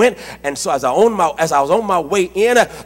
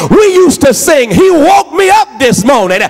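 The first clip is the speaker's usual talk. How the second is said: full-force preaching; 10 semitones higher, 5 dB louder than usual. Real, not acted. Preacher rousing the congregation about the heavenly Father, full-force preaching. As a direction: cry it out like a preacher in full flight.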